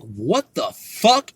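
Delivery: slow, drawn-out what the fuck; slow stunned reaction to the alien news; stunned disbelief. Retold or imitated. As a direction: let it hang in disbelief. Teen hearing about aliens.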